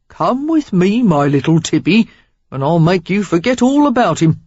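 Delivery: a cocky Cockney mocking his employer over stolen wine; Cockney stranger. A narrator's reading. Stranger sneers at Craven to Sarah, cheeky Cockney accent.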